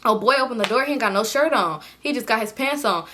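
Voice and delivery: ordinary talk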